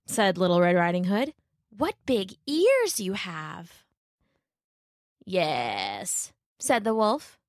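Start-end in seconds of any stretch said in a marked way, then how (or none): 1.7-3.8 put-on slow, lightly girlish wonder; wondering Little Red. A narrator's reading. Little Red studying grandmother, slow mild wonder. 5.2-6.3 low, drawn-out sly answer; wolf as grandmother. A narrator's reading. Wolf answering Little Red, low and sly.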